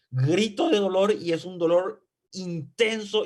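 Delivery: ordinary delivery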